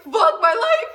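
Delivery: high, loud, laughing through it. Real, not acted. Girl laughing as her crush asks for her number. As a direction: cackle in happy disbelief.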